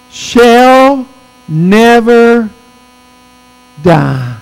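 word by word, long pauses between; slow reading. Shown as a demonstration. Preacher reading a key verse to the congregation, very slowly. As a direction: draw out each word slowly and deliberately. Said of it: "You gotta read it slowly".

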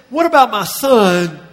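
a father's loud anxious plea; anguished cry. Shown as a demonstration. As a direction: call out for the son, aching. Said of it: "No, he says".

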